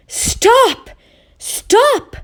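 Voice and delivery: very high loud howl. Acted. Oscar watching oranges fall, howling very high. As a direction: howl in panic. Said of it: "howled Oscar".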